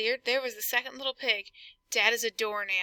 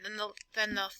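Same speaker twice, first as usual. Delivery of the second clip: ordinary delivery, nothing marked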